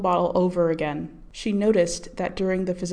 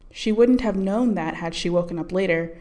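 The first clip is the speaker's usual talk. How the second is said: usual speaking voice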